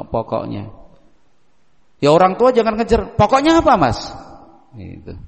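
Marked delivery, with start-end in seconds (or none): 3.2-4.1 mock parent asking high and eager; parent pressing. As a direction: push the child for a real answer. Parent pressing child on phone.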